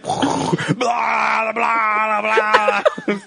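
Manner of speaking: drawn-out pretend puking noise; mock vomiting. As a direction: imitate someone heaving out great blah noises. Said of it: "I hear him puke".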